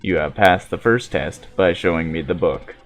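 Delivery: low, measured android speaking evenly; steady, solemn machine prophet; calm robot voice. Acted.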